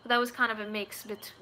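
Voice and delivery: ordinary talk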